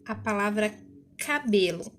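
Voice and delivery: usual speaking voice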